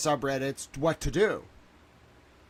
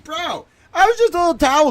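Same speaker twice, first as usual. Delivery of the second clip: much higher and louder real outcry; frantic protest; 13 semitones higher, 11 dB louder than usual. Real, not acted. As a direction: cry out your innocence. Panicked protest.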